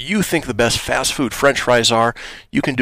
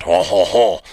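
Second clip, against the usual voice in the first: mock French accent, a jokey hon-hon-hon; a hammed-up Frenchman fumbling for a word; 3 semitones lower than usual. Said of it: "let's see if I can do it in a French accent".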